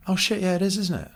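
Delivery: high, surprised mock voice of someone caught out; mock horror in a squeaky high voice; shocked realization. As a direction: play the embarrassed offender realising. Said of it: "And they go".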